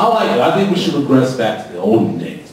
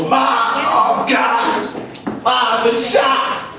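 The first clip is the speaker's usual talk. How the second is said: higher, very slow, strained plea; panicked son; 10 semitones higher, much slower than usual. Retold or imitated. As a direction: drag it out like a wounded son. Son reporting being shot.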